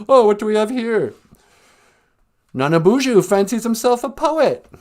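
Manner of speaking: loud taunting teacher voice; mocking headmaster. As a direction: jeer at the pupil like a strict headmaster. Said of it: "headmaster in the Pink Floyd The Wall video".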